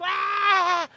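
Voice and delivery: loud, high drawn-out roar; mock crowd scream. Audience goes wild for CEO, mock screaming. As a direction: imitate a screaming fan crowd.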